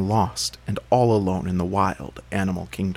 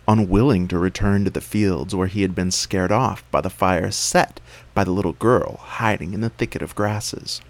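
ordinary delivery, nothing marked

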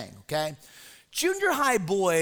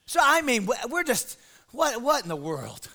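normal talking voice

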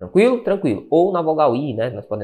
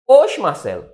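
usual speaking voice

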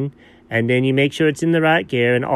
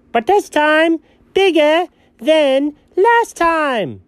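dramatic announcement; 11 semitones higher, much slower than usual. Acted. Dramatic Death Star reveal.

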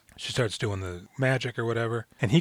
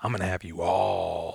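creepy ghost voice; 5 semitones lower than usual. Retold or imitated. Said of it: "she goes".

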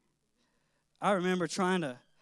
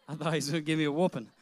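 ordinary delivery

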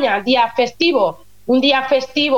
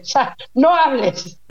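laughing through it, slow; giggling while finishing the joke; slower than usual. Real, not acted. Telling the host Germans stay silent, laughing.

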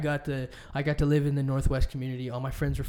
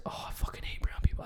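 breathy whispered aside, almost under the breath; 4 dB quieter than usual. Pretend. Telling a friend about rude customers, a whispered aside. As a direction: whisper it like a guilty confession.

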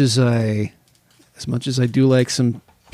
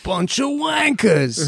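high, drawn-out British jeer; 8 semitones higher, slower than usual. Acted. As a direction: draw it out in a high, put-on British voice. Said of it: "impression of [name] doing his impression of [name]".

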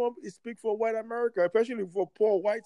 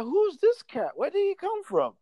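retold puzzlement; 9 semitones higher than usual. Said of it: "But I'm like".

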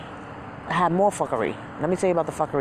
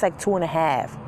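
normal talking voice

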